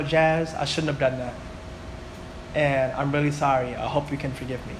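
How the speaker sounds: hushed, earnest asking of forgiveness; sincere apology. Retold or imitated. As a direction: retell a humble apology, dropping the voice. Retelling his apology to his sister.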